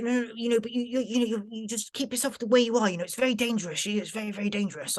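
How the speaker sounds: retold anxious advice; imitated worried bloke. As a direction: stumble through a cautious warning.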